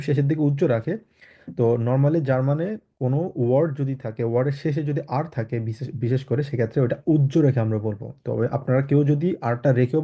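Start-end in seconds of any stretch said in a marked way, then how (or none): none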